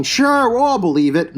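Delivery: ordinary talk, nothing marked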